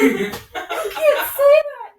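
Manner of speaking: high, loud, drawn-out mock horror; scandalized parent, played for laughs; shocked scolding. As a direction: gasp it out, half laughing. Retells own shocked reaction to child.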